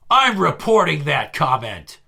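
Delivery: loud, slow declaration; indignant threat. Retold or imitated. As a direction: announce it slowly and loudly, outraged. Acted threat from offended coworker.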